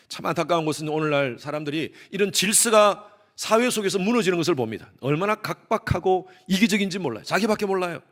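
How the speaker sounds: usual speaking voice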